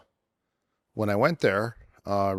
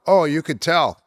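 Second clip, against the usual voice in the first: higher and louder mock dig; teasing quip; 8 semitones higher, 8 dB louder than usual. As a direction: tease the newbie with a dry jab. Judo guys tease newcomer.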